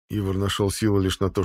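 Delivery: ordinary delivery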